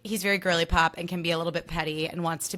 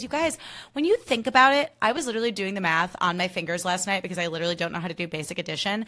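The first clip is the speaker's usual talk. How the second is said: usual speaking voice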